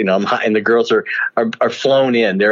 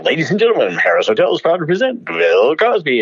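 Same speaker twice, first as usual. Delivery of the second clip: raised, projected showroom introduction; show announcer; 6 semitones higher than usual. Retold or imitated. Announcer presenting the star at a hotel show, showy introduction. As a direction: announce the headliner with a high, building call.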